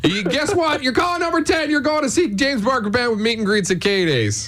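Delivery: high, excited winner announcement; radio contest promo. Acted. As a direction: build it up, then reveal the prize.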